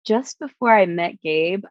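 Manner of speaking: ordinary delivery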